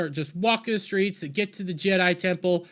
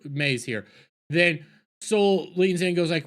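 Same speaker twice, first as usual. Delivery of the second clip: normal talking voice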